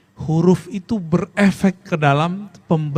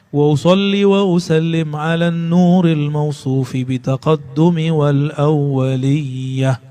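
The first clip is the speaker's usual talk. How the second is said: slow, chanted reading of the Arabic; devotional recitation; 2 semitones lower, slower than usual. Acted. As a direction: recite the salawat slowly and reverently. Recites Arabic salawat text.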